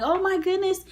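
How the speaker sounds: very high re-enacted exclamation; high-pitched, acted-out distress; retold desperation. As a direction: exclaim way up high in mock panic.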